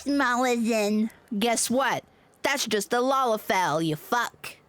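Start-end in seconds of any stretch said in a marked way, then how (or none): none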